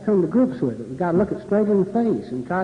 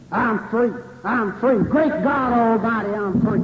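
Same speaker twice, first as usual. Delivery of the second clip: raised, ringing preacher's proclamation; Baptist preacher; 3 semitones higher, 3 dB louder than usual. Acted. Speaker quoting a great preacher on freedom, preacher's exultant cry. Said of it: "words of that must be the greatest Baptist preacher".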